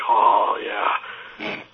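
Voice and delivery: low contented sigh of relief; blissful relief. Retold or imitated. Freezing visitor basking in a space heater's heat, blissful relief. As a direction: sink into the warmth, voice dropping away.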